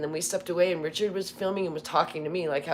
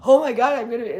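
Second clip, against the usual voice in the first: self-important gasp; 7 semitones higher, 10 dB louder, faster than usual. Retold or imitated.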